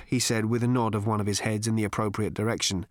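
usual speaking voice

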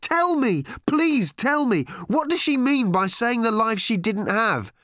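high, loud tearful tantrum; furious teenage girl. A narrator's reading. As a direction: scream it out, close to crying. Random raging at Arthur.

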